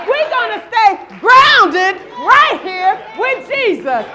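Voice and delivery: loud, high, driving call to the congregation; full-force preaching. Acted. Pastor rallying the congregation to stay with Jesus, shouting sermon.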